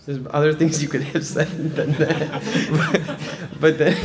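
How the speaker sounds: chuckling through the words; amused real laughter; laughing. Real, not acted. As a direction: laugh as you tell it. Laughing at his own story.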